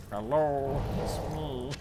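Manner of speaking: lightly voiced wolfman; a hint of a spooky wolfman. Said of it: "It is the Wolfman".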